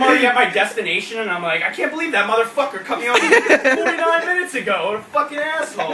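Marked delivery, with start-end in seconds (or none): 1.6-5.9 hammed-up fuming rant, a bit higher; mock road rage. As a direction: still fuming at the driver who cut you off. Still fuming at long-gone driver.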